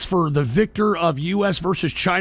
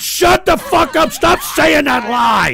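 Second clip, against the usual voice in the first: angry shout; 8 semitones higher, 6 dB louder than usual. Real, not acted. Host shouting down a caller's lie, furious. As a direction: bellow the denial in real anger. Denies 9/11 mockery accusation.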